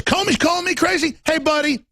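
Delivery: mock him up high and sneering; mocking Trump. Host mimics Trump scoffing at Comey, pitched high. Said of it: "he says".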